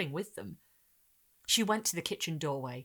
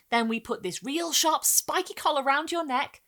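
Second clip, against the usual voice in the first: put-on cooing boy; 9 semitones higher, 6 dB louder than usual.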